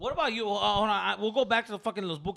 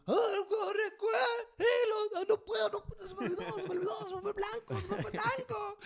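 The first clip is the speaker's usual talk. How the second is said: flustered fan forgetting the words. Retold or imitated. Mocking a friend frozen with the mic in front of the singer, high and laughing. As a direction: play the starstruck fan who blanks on the lyrics. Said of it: "Jerry's gonna be like".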